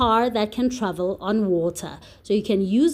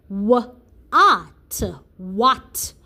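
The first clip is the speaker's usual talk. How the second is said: slow phonics spelling. Shown as a demonstration. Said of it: "W H would sound like this".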